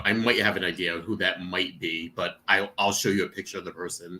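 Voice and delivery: quiet aside about a possible fan; discreet. Real, not acted.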